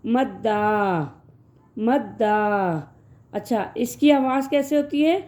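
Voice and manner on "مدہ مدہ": slow naming. Shown as a demonstration. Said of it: "اس کو کہتے ہیں".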